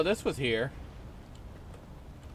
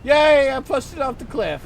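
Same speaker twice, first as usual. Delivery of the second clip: excited cheer; 9 semitones higher, 7 dB louder than usual. Real, not acted. Cheering at own game success.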